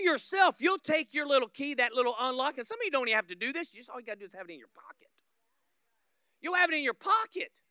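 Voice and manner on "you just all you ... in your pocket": fast, breathy whispered throwaway; a quick whisper to the front row; whispered aside. Acted. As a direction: rush it under your breath.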